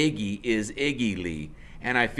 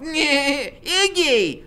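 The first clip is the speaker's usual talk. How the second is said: much higher, louder yelling call for Iggy; rough woman calling; 9 dB louder than usual. Acted. Woman in doorway yelling for her man, loud. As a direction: holler into the apartment. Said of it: "this woman's voice is cussing us out".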